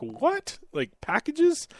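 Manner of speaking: high, incredulous "what, packages?"; mock surprise. Retelling the hotel call, voice jumps up in disbelief. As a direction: pitch it way up in disbelief. Stunned reply to hotel staff.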